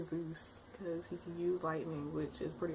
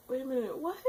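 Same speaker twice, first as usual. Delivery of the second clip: disbelief; 7 semitones higher, 5 dB louder, faster than usual. Retold or imitated. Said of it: "So I was like".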